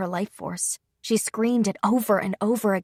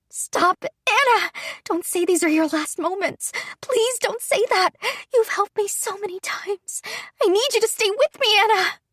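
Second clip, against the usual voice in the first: desperate pleading; 12 semitones higher than usual. Acted. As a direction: beg her not to give up, voice cracking. Pleading with dying friend.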